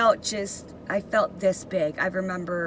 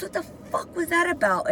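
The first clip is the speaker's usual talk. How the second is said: retold yell; 10 semitones higher, faster than usual. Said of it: "yelling at me".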